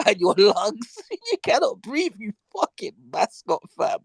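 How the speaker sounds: high-pitched scolding through laughter; real incredulous laughing rant; mocking laughter. Real, not acted. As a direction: ridicule him while cracking up. Mocks choked man, laughing hard.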